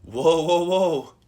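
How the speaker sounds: alarmed inner voice. Acted. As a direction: interject like a worried inner voice. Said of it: "your own attention being like".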